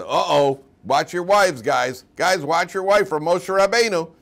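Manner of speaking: loud, high warning to the crowd; mock alarmed warning. Rumour spreaders warning the guys, mock alarmed. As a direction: raise the alarm, hammed-up. Townspeople acted warning each other.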